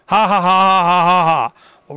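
slow, spelled-out villain laugh; hammed-up fake gloating ha ha ha; mock gloating laugh. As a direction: cackle like the smug enemy. Mimicking gloating enemies laughing.

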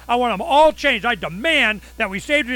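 high, loud, indignant demanding; mock outraged demand. Owner demanding the city change every valve, hammed-up outrage. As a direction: ham up the outraged customer. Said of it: "I'm going".

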